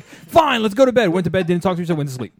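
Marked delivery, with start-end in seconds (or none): 0.0-1.1 high, loud mock spousal retort; retold yelling. Husband voicing his wife's reply in their fight, yelling. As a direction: imitate a wife yelling back in exasperation. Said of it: "literally yelling at each other".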